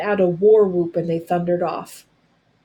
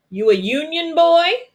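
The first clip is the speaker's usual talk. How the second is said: high, louder mocking taunt; jeering; 8 semitones higher, 4 dB louder than usual. A narrator's reading. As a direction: sneer it at the captive boy. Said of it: "one jeered".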